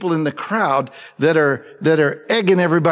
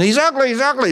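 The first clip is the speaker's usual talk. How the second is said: mock crowd yell; 8 semitones higher, faster than usual. Preacher mimics an instigator in the crowd, in a pitched-up mock yell. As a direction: imitate a heckler egging on the crowd. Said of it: "and you start yelling".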